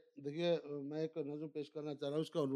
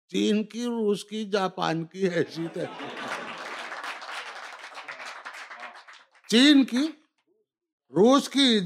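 measured, declaimed verse lines; stately nazm recitation; 6 semitones higher, 11 dB louder, slower than usual. Acted. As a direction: recite each line, then repeat it louder. Recites nazm Aisi Taisi.

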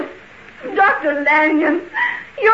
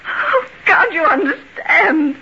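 crying, half-shouted plea; tearful outcry; 2 semitones higher than usual. Acted. Helen crying out because nobody believes her. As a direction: break into a sob of frustration.

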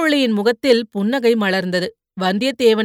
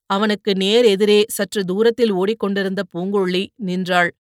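ordinary delivery

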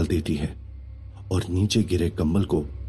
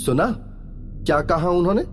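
put-on high girl's voice, smug; gleeful, triumphant girl; 9 semitones higher than usual. A narrator's reading. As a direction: rub it in with a raised pitch. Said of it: "उस लड़की ने खुशी से".

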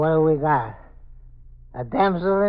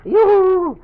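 much higher, loud sing-song call; drunken yoo-hoo; 9 dB louder than usual. Acted. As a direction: holler it up high and tipsy. Drunk calling to a trapped woman.

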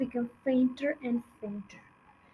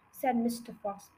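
ordinary delivery, nothing marked

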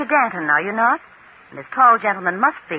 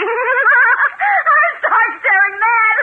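very slow, much higher and louder, laughing and crying; mad laughter; 11 semitones higher, 5 dB louder, much slower than usual. Acted. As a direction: cackle it out like she has lost her mind. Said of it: "What are you laughing at?".

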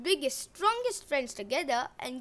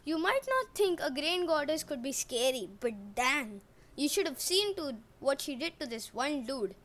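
plain reading voice

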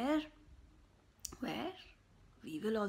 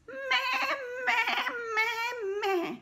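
much higher, loud bleating; goat bleats; 13 semitones higher, 6 dB louder, much slower than usual. Acted. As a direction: bleat like goats. Goats bleating.

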